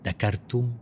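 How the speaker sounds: hushed, slow bilingual poetry reading; poem recitation. Acted. Poet evoking the wind in exile, slow sparse recitation. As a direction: space out each short phrase like a breath.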